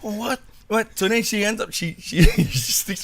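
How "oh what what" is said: pumped up. Retold or imitated. Niece riled by daughter's goading, pumped up. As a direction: rise to the bait in a high voice. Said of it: "my niece is getting pumped up".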